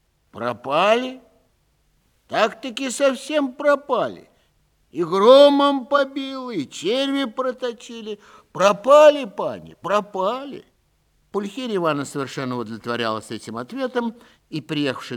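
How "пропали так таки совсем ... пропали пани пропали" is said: high, slow and loud put-on peasant whine; whining steward. Steward excusing himself to the lady, high drawn-out whine. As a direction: plead the oaks away with a long sorry wail.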